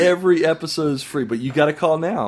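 loud, hammed-up TV sales pitch; infomercial. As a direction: sell it like a late-night TV ad. Mock infomercial pitch, louder.

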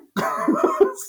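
a sudden high laugh, loud and bright; high burst of laughter. Real, not acted. Telling podcast hosts about fleeing the scene, laughing high. Laughing at own quick exit.

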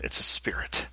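hushed whisper. Acted. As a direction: whisper it in awe. Awed aside to audience.

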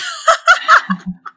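loud embarrassed laugh; high laugh at herself; burst of laughter. Real, not acted. Embarrassed at mispronouncing.

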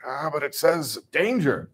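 slow, timid hesitation; hesitant subject. Retold or imitated. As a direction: hesitate nervously at the dial. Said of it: "hesitate and be like".

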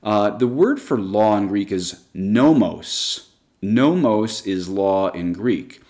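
nomos said slowly as NO-mos, both syllables clear; Greek word slowly spelled out. Shown as a demonstration. Teacher introduces the Greek word nomos, slow and deliberate. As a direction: sound out nomos slowly for learners. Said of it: "I want to look at that word".

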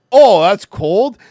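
loud, slow mock disbelief; mock incredulous Canadian; mocking northerner. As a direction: sneer it out like an unimpressed northerner. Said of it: "they're like".

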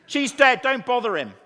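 mock-brutal Yorkshireman breaking bad news. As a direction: deliver it with no tact at all. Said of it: "They're a bit brutal".

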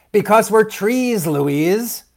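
exasperated sibling correction. A narrator's reading. Merwin exasperated with Louise.

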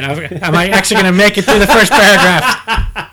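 breaking into laughter mid-question; cracking up, struggling to keep reading; laughing. Real, not acted. Host losing it while reading the story aloud, laughing.

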